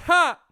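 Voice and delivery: loud, high mock "ha!"; retold shocked gasp. Audience man reacts to the comic's slip, loud shocked gasp. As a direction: re-enact a scandalised audience outburst.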